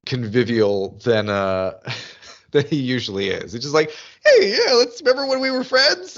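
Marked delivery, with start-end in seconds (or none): 4.2-6.2 very high, over-eager chummy voice; hammed-up attempt at being friendly; mock conviviality. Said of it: "trying to be more convivial".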